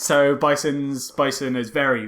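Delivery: ordinary delivery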